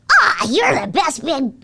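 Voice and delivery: slow, high, loud little brother voice; strained little cartoon kid, slow; Jake. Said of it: "I used a voice that I couldn't hold".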